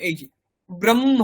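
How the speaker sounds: ordinary talk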